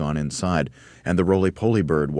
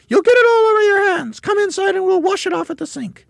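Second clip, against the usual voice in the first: very high, loud, screechy scolding; crying out; 8 dB louder than usual. A narrator's reading. Mrs Twit sends husband to the sink, crying out. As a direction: screech orders at him. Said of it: "cried Missus Twit".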